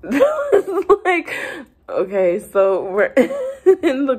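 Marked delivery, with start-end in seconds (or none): 0.0-1.3 high, drawn-out laughing words. Real, not acted. Cracks up recalling the excuse. 3.1-4.2 breaking into laughter mid-sentence; laughing. Real, not acted. Giggling fit while narrating.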